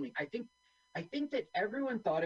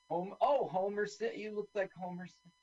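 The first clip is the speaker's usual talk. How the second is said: usual speaking voice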